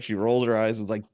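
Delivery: usual speaking voice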